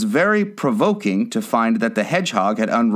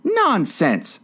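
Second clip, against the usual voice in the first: high, decisive retort; decided, high-pitched dismissal; loud and decided; 11 semitones higher than usual. A narrator's reading. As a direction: cut in firmly, pitch jumping up. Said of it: "said Alice very loudly and decidedly".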